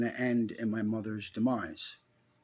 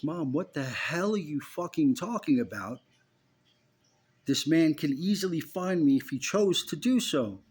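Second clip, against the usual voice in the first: loud, higher, exasperated demand; rising to a frustrated yell at mom; 5 semitones higher, 4 dB louder than usual. Retold or imitated. Son yelling at his mother over the phone, exasperated.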